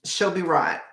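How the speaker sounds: put-on light Aussie accent; a hint of Australian in the vowels. Streamer quoting how Aussies reassure, light Australian accent. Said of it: "That's the Olsies would say".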